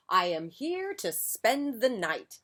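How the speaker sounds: bright, eager little mouse, slow; a happy small mouse arriving; cheerful mouse voice. A narrator's reading.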